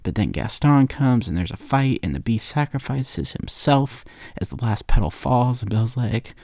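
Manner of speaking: ordinary delivery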